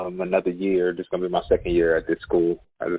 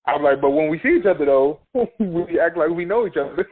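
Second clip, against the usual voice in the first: raised, laughing explanation; laughing reply; 8 semitones higher than usual. Retold or imitated.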